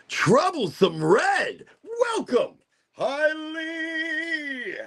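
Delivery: high, sing-out greeting with long-held words; excited welcome. Real, not acted. Streamer welcoming viewers who join, excited high sing-out. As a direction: call out names in a soaring high voice.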